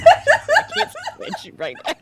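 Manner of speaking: words squealed out high and loud through laughter; helpless laughter. Real, not acted. Friend reacting to nearly spitting water, helpless high laughter. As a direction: crack up loudly while talking. Cracks up at the other speaker.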